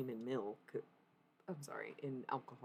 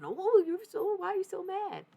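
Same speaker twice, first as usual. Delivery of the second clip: much higher, louder mock voice of people; mocking whiny critics; 12 dB louder than usual. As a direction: parrot them in a shrill mock squeal. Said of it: "Now all of a sudden".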